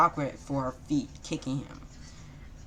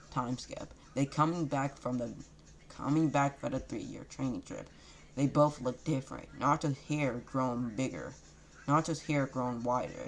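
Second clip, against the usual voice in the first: ordinary delivery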